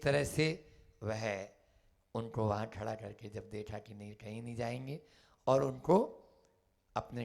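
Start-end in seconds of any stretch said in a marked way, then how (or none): none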